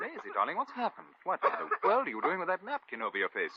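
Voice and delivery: high, loud flustered questioning; alarmed. Acted. Suitor finding his darling behind a napkin, alarmed. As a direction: pitch up in loud, worried disbelief. Alarmed at her hidden face.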